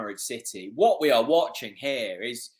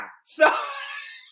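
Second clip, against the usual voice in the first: laughing whoop. Real, not acted. Fan celebrates the goal replay.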